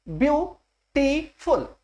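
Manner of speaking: Beautiful slowly broken into view, टी, फुल; syllable by syllable. Shown as a demonstration. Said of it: "तीन syllable हो गए". (Beautiful को धीरे-धीरे तीन हिस्सों में, ब्यू-टी-फुल)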